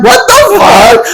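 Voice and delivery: high, drawn-out disbelief; shocked outburst. Retold or imitated. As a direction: retell it as an appalled, high-pitched reaction. Acting out shocked reply to text.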